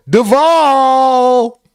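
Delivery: high, sustained holler; drawn-out Duval call. Shown as a demonstration. Host showing off the old Duval call, drawn-out and high. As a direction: hold the last syllable of DUVAL long and high. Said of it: "Damn, I used to sound like".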